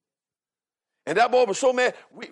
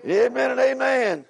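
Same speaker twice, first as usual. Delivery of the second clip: high, emphatic pulpit delivery; fervent preaching; 5 semitones higher than usual. Real, not acted. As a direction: drive the point home in a raised voice. Preaches with raised voice.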